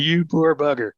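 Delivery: chuckling pity for the speaker; a hint of amused sympathy; laughing imitation. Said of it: "He was probably saying".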